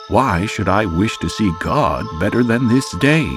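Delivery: usual speaking voice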